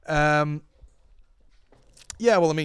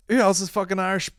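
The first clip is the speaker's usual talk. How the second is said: ordinary talk, nothing marked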